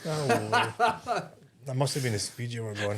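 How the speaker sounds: laughing while talking; words through real laughter. Real, not acted. Laughing at a funny story.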